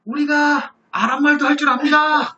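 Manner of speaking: high, drawn-out grovelling voice; servile pleading. Retold or imitated. Timid envoys approaching the mocking Assyrian, servile. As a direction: beg apologetically, stretching the phrase endings. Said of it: "이렇게 비굴하게 얘기를 했어요".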